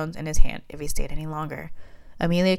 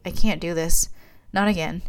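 ordinary delivery

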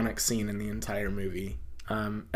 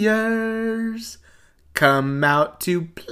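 imitating a taunting gang leader, \"Warriors\" drawn out high; a lightly voiced taunting call, slow at the end; sing-song taunt; 11 semitones higher, 4 dB louder, much slower than usual. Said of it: "his voice continuously gets higher and higher".

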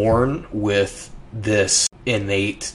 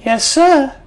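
put-on high, sweet southern child saying yes sir; a child's high, southern-tinged yes sir; little girl's voice; 7 dB louder than usual. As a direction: voice the polite little girl. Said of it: "The child says".

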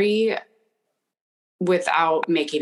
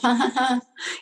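light, higher-pitched mock laugh; acted brush-off laugh; 8 semitones higher than usual. Not wanting to ruffle anyone's feathers, light put-on laugh. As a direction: laugh it off to dodge a disagreement. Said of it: "I'm just gonna, you know, laugh".

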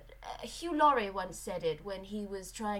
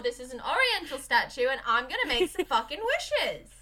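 louder, exasperated telling-off; snappy wife; 5 semitones higher, 6 dB louder than usual. Retold or imitated. Podcaster voicing the wife snapping at her husband, loud. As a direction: shut him down impatiently. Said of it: "she's like".

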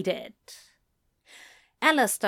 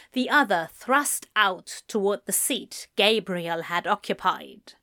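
usual speaking voice

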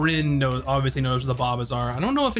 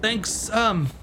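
higher-pitched, haltingly flustered character; flustered local; 9 semitones higher than usual. Acted. Handed a soaking business card, mildly flustered and halting. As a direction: stumble through a polite, flustered thanks.

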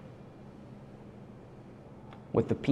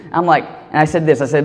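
ordinary talk, nothing marked

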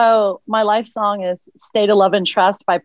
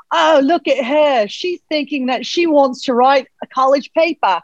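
put-on Australian accent, pitched up; Steve Irwin impression; 4 semitones higher than usual. Guest voices the host reading an animal's thoughts, Steve Irwin impression. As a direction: marvel at an animal in broad, lilting Australian. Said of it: "a Steve Irwin impression".

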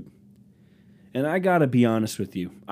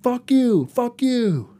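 much higher, shrill mock rant; mock furious customer; 12 semitones higher, 4 dB louder than usual. At the Taco Bell drive-thru, a hammed-up angry yell. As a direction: rage like an entitled customer over a taco. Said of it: "be like".

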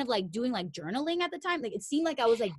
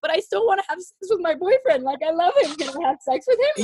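much higher, louder whiny plea; pleading self; 8 semitones higher, 9 dB louder than usual. Retold or imitated. She pleads her case about her boyfriend, high and whiny. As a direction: whine it like a torn girlfriend. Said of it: "I'm like".

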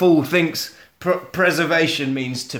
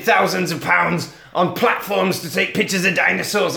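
worked-up rant, climbing to high yelps; mock rant; 3 semitones higher than usual. Jack rants at the monster hunters, acted anger. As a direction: build a hammed-up tirade, pitch jumping at the end. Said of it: "Calm down, Jack. Calm down".

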